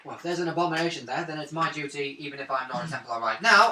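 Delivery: normal talking voice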